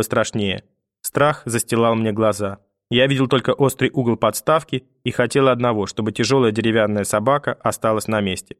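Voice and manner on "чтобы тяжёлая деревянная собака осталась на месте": usual speaking voice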